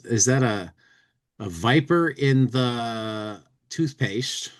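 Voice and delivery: ordinary talk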